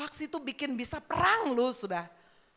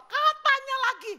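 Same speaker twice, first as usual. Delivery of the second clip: very high, louder mock reply; gossip passing blame; 12 semitones higher, 5 dB louder than usual. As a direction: shoot the voice up as the next gossip. Jawaban berantai asal gosip. (suara penggosip melengking, lebih keras)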